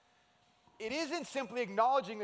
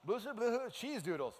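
mock Norwegian gibberish, light and playful; 4 semitones higher than usual. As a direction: fake Norwegian babble ending in cheese doodles. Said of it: "impression of Norwegian".

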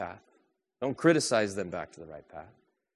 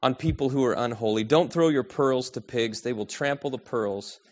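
preacher quoting Jesus to the congregation, raised and forceful; 7 dB louder than usual. Acted. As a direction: declare the words of Jesus. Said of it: "He says".

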